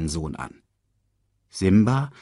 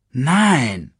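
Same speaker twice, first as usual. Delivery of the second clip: stern refusal, raised and louder; 6 semitones higher, 5 dB louder than usual. A narrator's reading. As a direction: refuse the cub strictly. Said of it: "erwiderte Scar streng".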